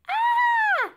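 very high, loud terrified scream. Acted. Girl attacked by a bird in the chimney, piercing scream. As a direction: scream in fright. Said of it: "screamed the girl".

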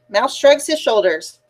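ordinary delivery

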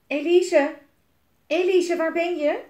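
loud, high searching call. A narrator's reading. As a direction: call for someone who is missing. Jelmer calls for missing Elise.

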